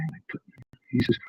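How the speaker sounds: usual speaking voice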